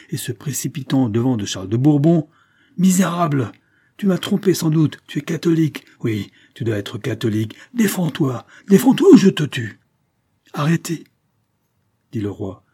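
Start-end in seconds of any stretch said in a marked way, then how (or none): 2.8-9.8 high, loud, enraged accusation; wrathful threat, pitch driven up; violent fury. A narrator's reading. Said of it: "la plus violente fureur succéda". 10.5-11.0 high, urgent call to stop; commanding interruption. A narrator's reading. King halts a sword attack.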